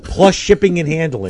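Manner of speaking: loud pitchman voice; infomercial. Pretend. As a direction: announce it like a TV ad.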